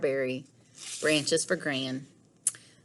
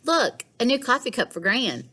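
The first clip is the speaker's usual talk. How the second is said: put-on a touch higher girl's voice; right voice for Lily; 6 semitones higher than usual. Shown as a demonstration. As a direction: redo it in Lily's lighter voice. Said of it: "Oh, wrong voice".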